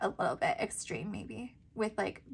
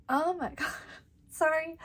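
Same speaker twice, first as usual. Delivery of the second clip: laughing; 6 semitones higher than usual. Real, not acted. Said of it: "sorry, I can't stop laughing".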